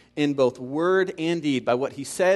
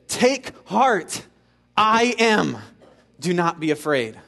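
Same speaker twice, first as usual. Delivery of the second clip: forceful proclamation; 3 semitones higher, 4 dB louder, slower than usual. Retold or imitated. Jesus declaring himself to his disciples, forceful proclamation. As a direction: proclaim it with divine authority, phrase by phrase.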